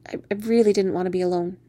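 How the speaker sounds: ordinary delivery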